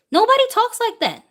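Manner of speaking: way up high, outraged disbelief; high incredulous exclaim. Real, not acted. To viewers, rejecting media claims, high incredulous exclaim. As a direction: throw the line up high in disbelief.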